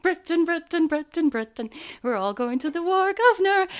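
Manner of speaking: hammed-up rousing British music-hall voice; mock jingoistic Brit. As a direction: parody a flag-waving Cockney, cheering to war. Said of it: "And it's like".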